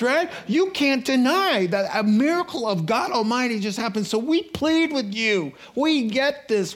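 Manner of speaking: pleading excitedly. Retold or imitated. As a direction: beg him to stay, swept up in joy. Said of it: "So then they pleaded".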